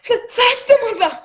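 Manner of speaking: loud, high panicked cry; terrified, gasping for her to run; boy fleeing pirates, calling in panic; panicked urging. Acted. Fleeing from scoundrels.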